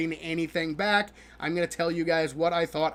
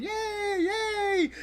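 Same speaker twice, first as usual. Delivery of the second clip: high squeaky yay of playing kids; mock kids cheering; 13 semitones higher than usual. Narrator voicing playing elf kids, mock cheerful. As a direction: cheer like a happy elf kid.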